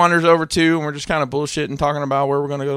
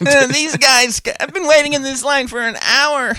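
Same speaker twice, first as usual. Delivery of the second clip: high-pitched imitation of a complainer; exaggerated high whine about waiting; mock whiny tattletale; 12 semitones higher, 5 dB louder than usual. Mimics a tattling line-waiter.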